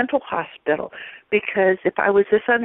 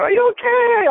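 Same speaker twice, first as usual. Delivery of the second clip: higher, louder call of a worried lifeguard; a light take on an anxious lifeguard; concerned lifeguard; 4 dB louder than usual. Retold or imitated. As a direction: call out over the water to check on her. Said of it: "the lifeguard comes out".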